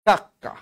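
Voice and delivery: কাকা said kak-ka with a held k; doubled consonant. Shown as a demonstration. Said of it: "কাকা হয়ে গেল কি?".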